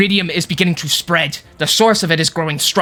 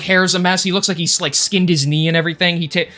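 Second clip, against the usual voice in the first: ordinary talk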